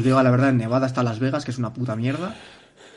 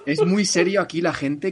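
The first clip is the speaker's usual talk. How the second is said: higher, laughing through the line; 4 semitones higher than usual. Real, not acted. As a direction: let the laugh carry the words. Still laughing at own joke.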